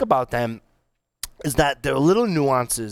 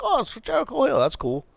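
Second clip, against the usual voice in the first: oblivious surprise; 13 semitones higher than usual. Retold or imitated. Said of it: "I was like".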